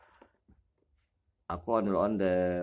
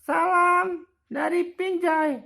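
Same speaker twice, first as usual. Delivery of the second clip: very high, louder squeaky greeting; squeaky toy voice; 6 dB louder than usual. Pretend. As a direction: greet like a tiny cartoon toy. Voicing Ipin toy greeting.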